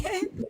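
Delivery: high, soft embarrassed giggle; squeaky little laugh. Real, not acted. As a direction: giggle at your own slip. Laughing at own slip.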